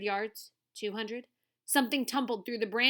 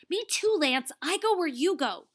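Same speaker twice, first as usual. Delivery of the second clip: louder, higher determined voice; firm insistence; 8 semitones higher, 6 dB louder than usual. Acted. Tuna insists on following Wahoo, determined. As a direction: dig in and insist.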